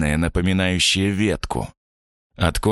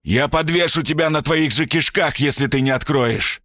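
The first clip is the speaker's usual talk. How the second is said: furious shout; 10 semitones higher than usual. Acted. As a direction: bellow threats at the door. Stranger threatens through the door.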